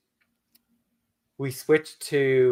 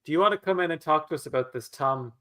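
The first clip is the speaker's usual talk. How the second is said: ordinary delivery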